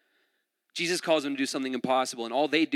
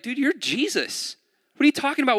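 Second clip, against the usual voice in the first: higher-pitched, exasperated disbelief; acted incredulity; 10 semitones higher, 4 dB louder than usual. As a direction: push back in baffled protest, getting louder. Acting out Philip's disbelief at Jesus.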